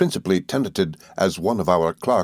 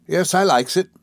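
put-on young servant girl; 10 semitones higher than usual. A narrator's reading.

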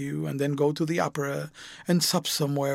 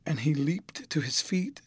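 ordinary delivery

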